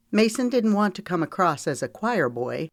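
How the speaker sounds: ordinary delivery, nothing marked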